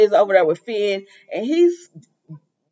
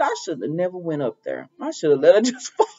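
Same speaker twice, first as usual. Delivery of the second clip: mild laughing quote; 4 semitones lower, 5 dB quieter than usual. Retold or imitated. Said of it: "Taylor said".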